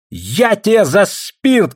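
high, slow, drawn-out threat; threatening shout. A narrator's reading. Ksenia threatens over the spirits, shouting. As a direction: draw the threat out in a high cry. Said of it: "кричала Ксения".

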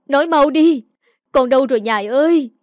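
loud, high, desperate shouting; wailed call for a missing daughter; frantic cry. A narrator's reading. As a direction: shout till your voice nearly goes. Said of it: "Bà nói là cả giọng đi".

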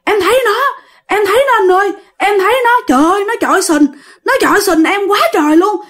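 high frightened rush; panicked telling. Retold or imitated. Friend telling dad about the ghost, frightened and high. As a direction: blurt it out in fear. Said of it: "chú Phú mới nói".

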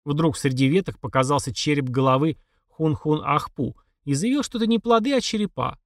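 ordinary delivery, nothing marked

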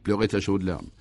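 ordinary delivery

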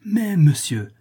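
high, exasperated comeback. A narrator's reading. Passer-by hitting back at the porter, high exasperated protest. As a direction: cut back in, pitched sharply up. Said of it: "reprit le gobe-mouche exaspéré".

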